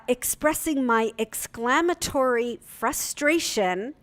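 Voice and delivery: ordinary talk, nothing marked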